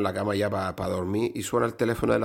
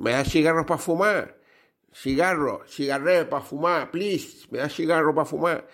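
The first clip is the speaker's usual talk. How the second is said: mock nasal, higher voice; hammed-up nasal customer; nasal speaker; 6 semitones higher than usual. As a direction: play a man who talks through his nose. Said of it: "hablaba así fañosillo, un poco gangoso".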